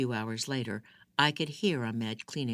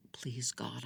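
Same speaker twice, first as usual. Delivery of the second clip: softer unvoiced whisper to God; whispered prayer; 8 dB quieter than usual. Hiding wife whispering a prayer in the bathroom, unvoiced whisper. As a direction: breathe the prayer so he can't hear. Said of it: "I whispered".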